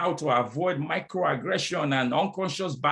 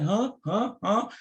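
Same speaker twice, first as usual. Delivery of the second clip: baffled huh; 7 semitones higher, slower than usual. Retold or imitated. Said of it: "And they're like".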